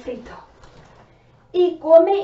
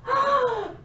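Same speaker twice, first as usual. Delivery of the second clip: startled cry; 12 semitones higher than usual. Acted. Meeting the monster.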